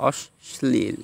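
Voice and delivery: অশ্লীল as "osh-shlil", in two parts; careful syllable split in অশ্লীল; doubled consonant. Shown as a demonstration. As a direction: hold and repeat the sh in অশ্লীল. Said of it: "এখানে দুইবার উচ্চারণ হয়েছে".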